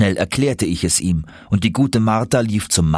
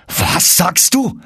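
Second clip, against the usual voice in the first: much higher, louder, slow astonished cry; excited; 10 semitones higher, 3 dB louder, much slower than usual. Acted. Uncle astonished at the news, excited slow cry. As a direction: drag it out in amazement. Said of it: "rief er so aufgeregt".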